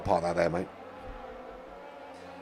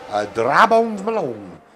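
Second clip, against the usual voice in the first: commentator drawls the name slowly in a mock accent, a bit louder; silly put-on accent; 2 semitones higher, 5 dB louder, slower than usual. Commentator naming a driver in his silly accent, slow and louder. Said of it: "I'm sorry I keep doing that accent".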